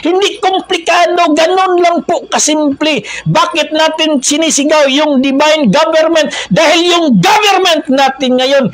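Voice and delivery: high, forceful exhortation; impassioned preaching. Real, not acted. Preacher denounces the present government as ungodly, impassioned preaching. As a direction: build to a high, stretched final cry.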